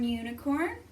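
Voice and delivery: usual speaking voice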